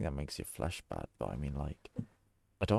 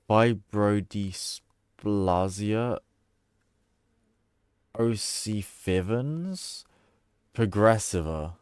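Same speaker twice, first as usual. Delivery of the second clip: sounding it out; 4 dB louder, much slower than usual. Real, not acted. Sounding out a hard medical word.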